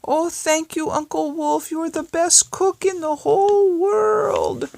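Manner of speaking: very high, squeaky chick voices; baby chicks thank Uncle Wolf, very high; delighted chicks. Acted. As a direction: chirp it out with joy.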